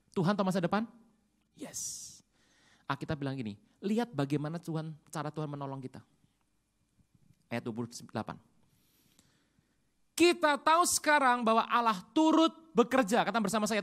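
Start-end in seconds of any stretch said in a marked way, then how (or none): 1.5-2.1 whispered yes. Real, not acted. Answering own question softly. 10.1-13.2 emphatic reading. Acted. Reading scripture aloud emphatically.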